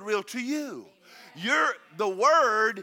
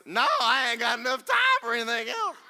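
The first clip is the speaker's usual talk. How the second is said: much higher, louder put-on country voice; Georgia customer; 6 dB louder than usual. Preacher mimics a diner refusing the cashier, high country voice. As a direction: play a hurried diner brushing off the cashier. Retelling joking Burger King exchange.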